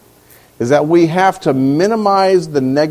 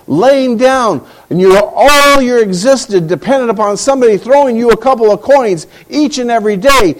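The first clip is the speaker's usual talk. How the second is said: ordinary talk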